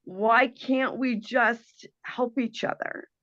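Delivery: ordinary talk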